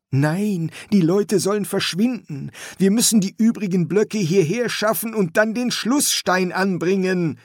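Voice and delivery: put-on high, eager young man; young son voice. Son's voice in story.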